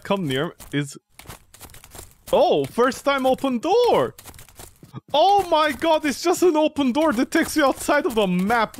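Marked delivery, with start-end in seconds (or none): 2.3-4.1 mock amazement. Real, not acted. Sarcastic mock amazement at door. 5.1-8.8 mock excitement. Real, not acted. Sarcastic excitement at a door.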